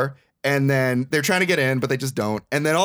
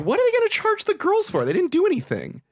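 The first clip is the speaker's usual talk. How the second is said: high-pitched baffled protest; acted-out indignant question; mock incredulous. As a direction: squeal it in exaggerated disbelief. Acting out own bafflement at plot.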